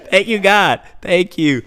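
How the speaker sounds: relieved thanks. Retold or imitated. Acts out relieved thanks, laughing before.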